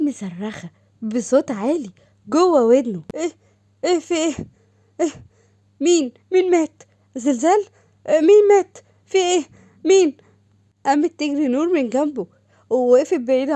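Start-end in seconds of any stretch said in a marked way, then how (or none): 3.1-10.2 high, loud bursts of alarmed questions; startled panic. Acted. Brother woken by a scream in his ear, panicked and loud. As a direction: jolt awake shouting in confusion.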